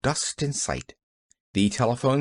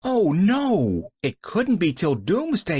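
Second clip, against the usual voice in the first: shocked; 7 semitones higher than usual. A narrator's reading. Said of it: "Pollyanna looked shocked".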